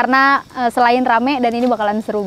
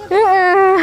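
laughing; 7 semitones higher than usual. Real, not acted. Laughing at scattering.

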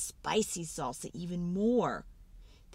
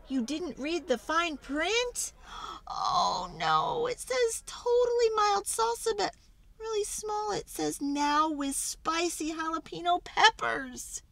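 alarmed; 9 semitones higher than usual. Acted. Discovering spicy salsa.

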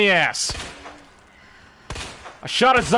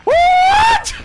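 very high, loud shriek; jump-scared yelp; startled scream; 8 dB louder than usual. Real, not acted. Streamer shrieks when Mister X appears, startled scream.

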